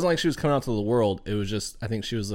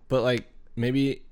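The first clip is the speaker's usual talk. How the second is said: normal talking voice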